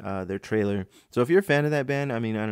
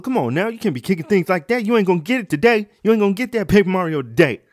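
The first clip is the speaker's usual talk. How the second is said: loud, high hammed-up father's rant; scolding dad; 9 semitones higher, 10 dB louder than usual. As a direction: lay down the law as dad. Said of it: "he goes".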